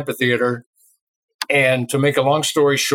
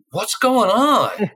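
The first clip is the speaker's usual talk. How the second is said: high, slow, drawn-out bewildered question; 8 semitones higher, much slower than usual. Retold or imitated. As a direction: stretch it out, totally lost. Confused at bouncer letting them.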